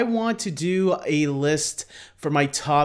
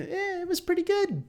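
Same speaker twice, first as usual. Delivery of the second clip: high, offhand "meh" verdict, softer; shrugging verdict; 5 dB quieter than usual. Retold or imitated. Recalling his old take on the first Pokemon movie, mock shrug. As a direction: toss it off like it barely impressed you. Retells own lukewarm verdict.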